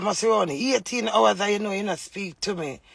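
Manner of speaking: usual speaking voice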